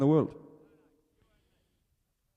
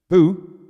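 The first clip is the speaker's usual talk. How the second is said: mock boo; 5 semitones higher, 10 dB louder than usual. Leading crowd booing.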